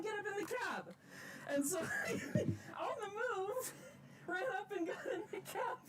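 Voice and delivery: high, breathless, laughing through it; giddy excited retelling. Real, not acted. Rail fan recounting his turn at the throttle, giddy and high. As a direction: tell it bursting with joy.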